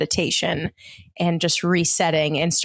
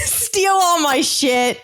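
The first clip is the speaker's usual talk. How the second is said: laughing; 10 semitones higher, much slower than usual. Real, not acted. Laughing pirate joke.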